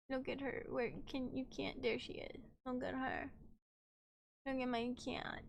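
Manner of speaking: sick voice. Pretend. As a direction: play up being sick, barely there. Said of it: "I was leaning into sick voice".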